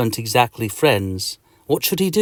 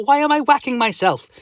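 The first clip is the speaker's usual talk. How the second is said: puzzled protest; 12 semitones higher than usual. Acted. Hero yells after slapping himself.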